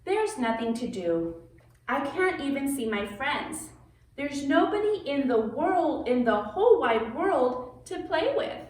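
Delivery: lively picture-book read-aloud; reading to children. A narrator's reading. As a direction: read it like story time for kids.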